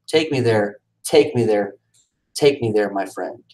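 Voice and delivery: usual speaking voice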